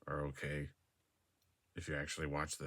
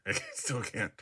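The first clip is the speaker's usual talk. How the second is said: laughing; 4 semitones higher than usual. Real, not acted. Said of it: "I'm always laughing".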